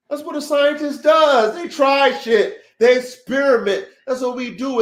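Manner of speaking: high, loud, fired-up declaration; rally the listeners like a preacher; fired-up preaching. Real, not acted. Dog breeder hyping up his chat, loud and fired up.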